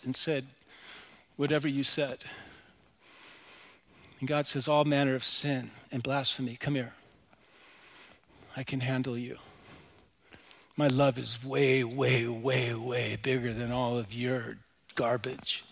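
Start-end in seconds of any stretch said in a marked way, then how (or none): 4.7-9.4 low, soft voice of God; gentle divine reassurance. Acted. God's gentle voice, acted. 10.8-15.6 low, intimate voice of God; tender divine reassurance. Acted.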